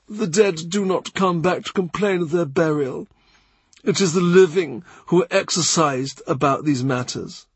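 lightly voiced measured churchman; Cranmer.

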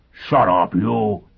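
loud, rough-spoken angry snap; savage outburst. Acted. Foyle leaping at the teacher who exposed him, angry. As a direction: lunge at her with the words.

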